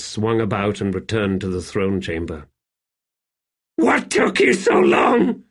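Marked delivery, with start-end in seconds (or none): 3.8-5.4 very high, drawn-out panicky demand; high, strained emperor in alarm; near panic. A narrator's reading. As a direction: demand an answer, close to panic. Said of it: "Rulad demanded in near panic".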